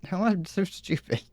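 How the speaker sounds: usual speaking voice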